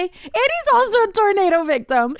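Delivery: high, hammed-up distraught plea; overwrought, near-tears whine; mess on the phone. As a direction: build to a loud mock wail. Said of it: "I was a mess on the phone".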